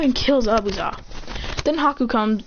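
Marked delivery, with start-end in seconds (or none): none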